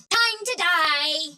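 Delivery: high, very slow taunt; drawn-out battle cry. Acted. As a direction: stretch it out high like a war cry. Battle taunt in game lines.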